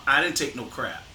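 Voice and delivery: normal talking voice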